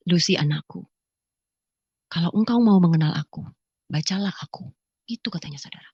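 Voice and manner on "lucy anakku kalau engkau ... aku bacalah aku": low, gentle voice from nowhere; lightly voiced low, measured summons; retold mysterious voice. Unseen voice calling a woman to read, low and measured. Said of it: "Suara itu berkata begini".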